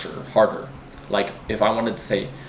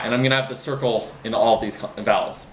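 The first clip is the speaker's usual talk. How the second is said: ordinary talk, nothing marked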